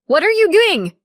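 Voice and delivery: very high panicked shout. A narrator's reading. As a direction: scream at her in alarm. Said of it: "my mom shouted".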